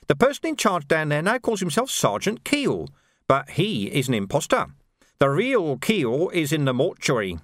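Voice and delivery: menacing Carcer with a sly, amused edge; sneering sergeant. Acted. Carcer taunting the Major about the burned watch house, sly. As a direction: needle the officers with mock concern.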